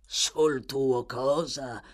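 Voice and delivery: disgusted snarl. Acted. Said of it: "ringhiò Sykes con sommo disgusto".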